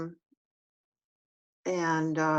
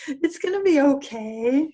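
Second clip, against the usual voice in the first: imitating a little child; 13 semitones higher than usual. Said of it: "they go".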